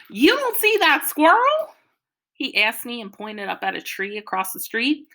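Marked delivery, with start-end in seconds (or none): 0.1-1.7 excited. Acted. Excited boy points at squirrel.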